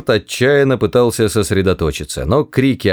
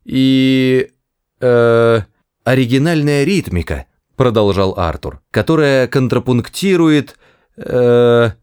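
faltering; 4 semitones higher than usual. A narrator's reading. Arthur improvising praise of the poem, faltering. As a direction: grope for words while praising. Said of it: "Он запнулся".

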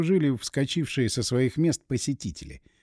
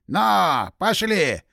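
driving horses on; 4 semitones higher, 3 dB louder, much slower than usual. Acted. Cabman urges horses on.

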